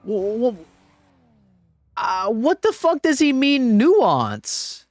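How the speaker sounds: high-pitched, disbelieving cry; can't believe what he read; incredulous. Real, not acted. Streamer baffled by a chatter's comment, high disbelief.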